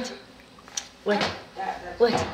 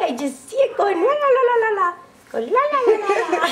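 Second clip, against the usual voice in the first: high, rapid tongue-wagging la-las; playful babbling imitation; 8 semitones higher, much faster than usual. Adult copying baby's little tongue, playful fast babble.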